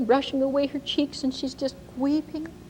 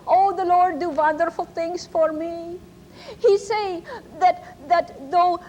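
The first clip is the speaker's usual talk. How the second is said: put-on higher, broken English in a German accent; 5 semitones higher than usual. Ma tells the church her sorrow, German accent. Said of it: "she speaks in kind of a broken English, kind of a German accent".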